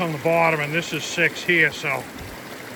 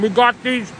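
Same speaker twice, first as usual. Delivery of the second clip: talking with a mouth full; words round a mouthful of biscuit; 5 semitones higher, 4 dB louder than usual. Real, not acted. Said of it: "I'm talking with my mouth full again".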